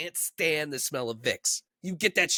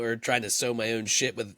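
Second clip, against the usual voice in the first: normal talking voice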